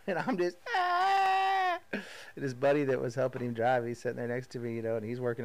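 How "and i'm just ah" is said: high, loud pained yelp; anguished cry. Retold or imitated. To the listeners, reliving the workout with a pained cry. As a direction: let out a strangled cry of exhaustion.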